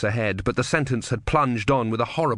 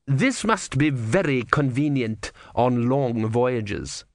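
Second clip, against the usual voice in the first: menacing inquisitor; much slower than usual. Acted. Vorbis slow.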